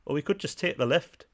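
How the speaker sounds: a terrible Geordie impression; Geordie accent. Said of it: "In my terrible Geordie accent there".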